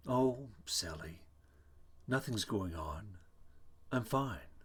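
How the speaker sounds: slow, faraway, serene; calm and dreamy. Acted. Sylvia on the phone to Frank, slow and dreamy. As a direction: drift through it as if half asleep. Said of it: "calmly, almost dreamily".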